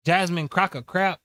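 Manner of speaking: normal talking voice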